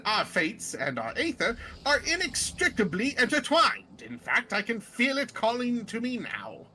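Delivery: reassuring, grandiose British gentleman zombie; British accent. Acted. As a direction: comfort them pompously in a British accent. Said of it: "I gave him a British accent".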